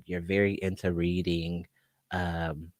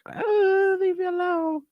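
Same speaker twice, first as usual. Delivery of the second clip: put-on much higher whiny kid voice; humiliated kid; slower than usual. Wet-bed kid whining at brother, pitched high. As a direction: whine it high like an embarrassed child. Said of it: "So, like".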